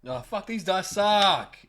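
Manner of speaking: high, loud, drawn-out complaint; real frustration. Real, not acted. Player cursing a bad dice roll, frustrated. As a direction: groan it out slowly and loudly.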